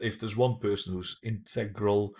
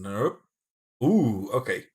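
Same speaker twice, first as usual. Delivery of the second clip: ordinary talk, nothing marked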